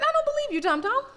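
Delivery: high, dismissive; doubting the GPS. Retold or imitated. She talks back to her GPS, high and dismissive. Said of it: "And I said".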